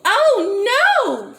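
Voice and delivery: high, loud storybook cry of alarm. A narrator's reading. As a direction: cry out in alarm as the frightened girl. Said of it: "she cried".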